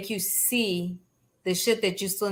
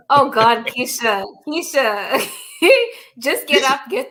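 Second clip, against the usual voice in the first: laughing; 3 semitones higher, 6 dB louder, slower than usual. Real, not acted. Laughing while teasing a friend.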